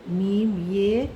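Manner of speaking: very slow letter names मीम ये; slow spelling. Shown as a demonstration. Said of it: "मेरा कैसे लिखोगे?".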